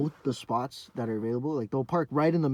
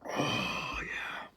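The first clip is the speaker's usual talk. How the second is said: whispered relish; 14 semitones lower, 7 dB quieter than usual. Real, not acted. Savoring first sip of beer.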